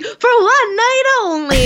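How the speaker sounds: high, slow vaudeville showman; old-timey announcer. Pretend. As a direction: announce it like an old-time theater barker. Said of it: "because it's like".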